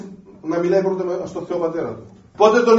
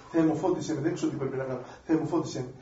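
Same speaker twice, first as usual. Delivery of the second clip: humble prayer; 5 semitones lower, 10 dB quieter, faster than usual. Acted. Humble sinner's prayer voiced.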